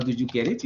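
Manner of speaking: the T of "get" clearly sounded, linked to "it"; T pronounced before vowel. Shown as a demonstration. Teacher contrasting rules, T of "get" pronounced. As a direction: land the T in "get it". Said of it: "the T is going to be pronounced".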